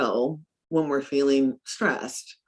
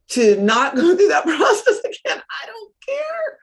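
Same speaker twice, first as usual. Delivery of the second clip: laughing; 12 semitones higher, 6 dB louder than usual. Retold or imitated. Woman laughing about overpaying to escape the loan, laughing wail. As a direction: laugh it out and break into a wailing give-up.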